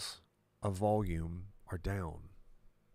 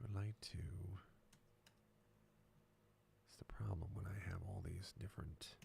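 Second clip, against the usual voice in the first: whispering; 12 dB quieter than usual. Real, not acted. Muttering to himself at the screen.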